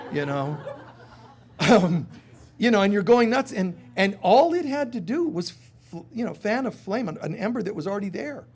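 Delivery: ordinary delivery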